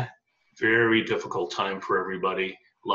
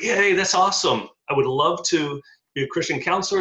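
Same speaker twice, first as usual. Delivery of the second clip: excited cheer; 7 semitones higher, 3 dB louder than usual. Retold or imitated. Retelling joy at counselor's praise.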